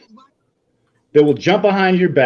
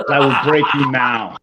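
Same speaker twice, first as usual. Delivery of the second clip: attempted Russian accent; put-on, amateur Russian accent; 3 semitones lower than usual. Shown as a demonstration. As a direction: have another go at a Russian accent. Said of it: "in a Russian accent".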